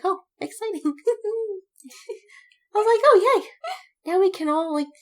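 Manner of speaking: high, sarcastic cheer; fake-happy about everyone being stressed; sarcastic cheer with a laugh; mock enthusiasm.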